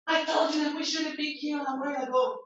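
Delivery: yelling. Shown as a demonstration. Teacher showing students how a yell sounds in a fight, fast high scold. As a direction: raise your voice at someone who kept you waiting. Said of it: "It's yell".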